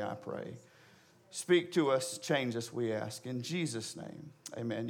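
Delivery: low, subdued prayer voice. Real, not acted. Preacher praying for the congregation before the sermon, subdued prayer. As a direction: pray quietly and reverently.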